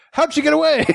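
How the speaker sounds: very high, frantic question; mock alarmed commissioner. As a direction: squeal it in comic alarm.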